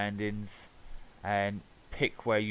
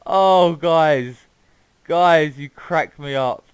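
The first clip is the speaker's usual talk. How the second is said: laughing; 5 semitones higher, 13 dB louder, much slower than usual. Real, not acted. Still laughing at the map.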